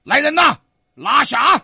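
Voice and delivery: loud, high magistrate's order; shouted command. A narrator's reading. Ghost magistrate orders guards to seize him, shouting. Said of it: "一喊拿下".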